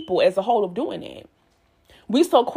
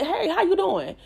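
bright, high hello to coworkers; cheerful greeting; 7 semitones higher than usual. Retold or imitated. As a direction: greet them all sunny and upbeat.

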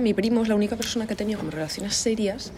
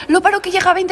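excited; 10 semitones higher, 6 dB louder than usual. Real, not acted. Rushing to stop recording.

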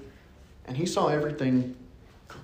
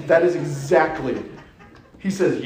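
ordinary talk, nothing marked

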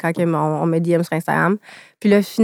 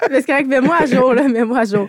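laughing; 6 semitones higher, 3 dB louder than usual. Real, not acted. Laughing while asking for update.

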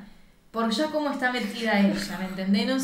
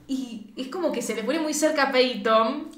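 ordinary delivery

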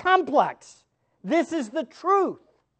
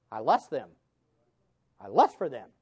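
low mock confession; 14 semitones lower, 3 dB quieter than usual. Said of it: "When in fact it's".

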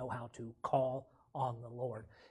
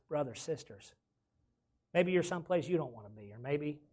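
usual speaking voice

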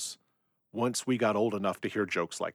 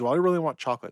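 higher, louder mock-annoyed insistence; a little upset; 6 semitones higher, 6 dB louder, much faster than usual. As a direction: play her as a customer getting fed up, in jest. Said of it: "a little upset".

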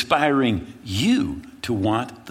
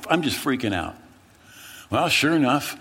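normal talking voice

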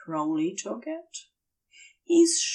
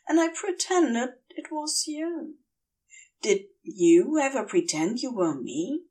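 tender letter voice; 5 semitones higher than usual. Acted. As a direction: read it like a longing, intimate letter. Character reading a letter.

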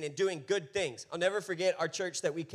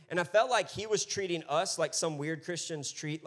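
ordinary delivery

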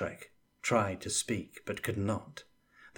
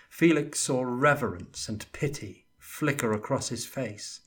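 ordinary delivery

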